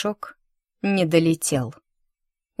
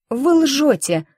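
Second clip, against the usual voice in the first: put-on higher, louder defiant girl; girl answering loudly; 8 semitones higher, 5 dB louder, slower than usual. A narrator's reading. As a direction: stand up to her out loud. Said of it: "громко ответила девочка".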